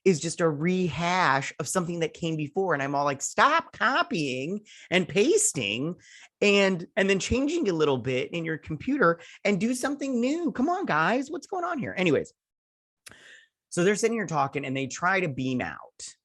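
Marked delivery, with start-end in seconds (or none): 3.2-11.9 exasperated rant. Retold or imitated. Ranting at the show's writers.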